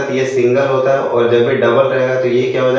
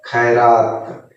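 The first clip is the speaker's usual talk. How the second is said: very slow, stretched खैरात; drawn out word; 2 semitones lower, much slower than usual. Shown as a demonstration. Teacher drags खैरात out slowly for pupils. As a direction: stretch every syllable of खैरात. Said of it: "तोड़ के पढ़ना है".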